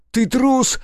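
very high, loud scream; shouted insult. A narrator's reading. Nikitin branding Rudnev a coward, screaming. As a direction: hurl the insult. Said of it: "выкрикнул он".

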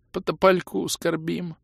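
slow, high-pitched sorrow; mournful sigh. A narrator's reading. Grieving elf answers the fighter, sighing mournfully. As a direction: lament it slowly in a high elf voice. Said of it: "вздохнул Орбит".

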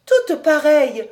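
put-on loud, high girlish exclamation; childlike joy. A narrator's reading. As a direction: cry out with a child's delight. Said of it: "avec une joie d'enfant". (exclamation joyeuse de jeune fille)

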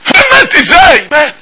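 sudden high gasp of disbelief; shocked disbelief. Retold or imitated. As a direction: recall the shock of hearing he died. Shocked news of friend's death. (دهشة وصدمة بصوت عالي)

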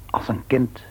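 hushed, almost voiceless; whisper. Acted. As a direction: breathe it like a confession. Father confesses he will pray.

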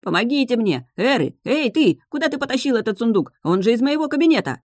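высокий, громкий крик на вора; кричит с неба на помощника; крик сверху. A narrator's reading. (возмущённый крик на помощника)

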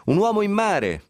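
high mock cry of man overboard; retold shout. Sailor spotting a man overboard, high mock shout. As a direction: call it out like a lookout, half-acted. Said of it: "quando si sente gridare".

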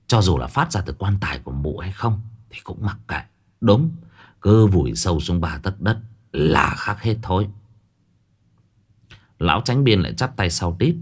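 ordinary delivery, nothing marked

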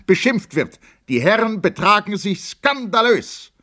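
very high, impassioned protest; indignant outburst. A narrator's reading. Lieutenant defends the Emperor's house to officers, indignant outburst. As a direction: declaim in outrage, pitch held high throughout.

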